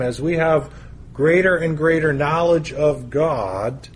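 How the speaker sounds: ordinary talk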